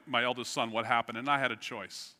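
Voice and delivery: usual speaking voice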